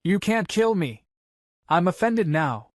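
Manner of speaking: high-pitched jeer, normal volume; mocking taunt. A narrator's reading. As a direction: lightly taunt a helpless enemy. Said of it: "and mocks him".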